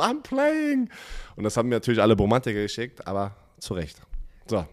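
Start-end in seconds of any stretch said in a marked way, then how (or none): 0.0-0.9 hoch gezogener, angeberischer Ausruf; übermütiger Spieler. Retold or imitated. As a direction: wie ein aufgedrehter Footballprofi rufen. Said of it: "Schiene einfach so abgerissen und hochgeworfen". (übermütiger Spielerruf)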